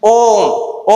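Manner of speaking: ओम with a long, held vowel; drawn-out ओम. Shown as a demonstration. Teacher showing students the long prolated vowel, ओम drawn out. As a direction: stretch the sound of ओम out slowly. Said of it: "वो थोड़ी लंबी चलती है".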